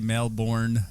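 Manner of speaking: Melbourne slowly as "mel-born", ending fully sounded; wrong pronunciation. Shown as a demonstration. Guest showing how you don't say Melbourne, full "born". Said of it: "you don't say".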